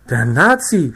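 loud startled outcry; shocked yelp at the corpse; a scared narrator crying out; horrified cry. A narrator's reading. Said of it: "schrie ich entsetzt".

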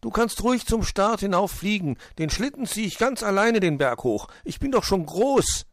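put-on high, loud childlike boast; eager little hedgehog. Borstel insists he can pull the sled alone, eager and high.

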